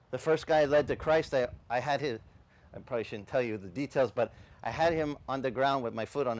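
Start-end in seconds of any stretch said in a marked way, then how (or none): none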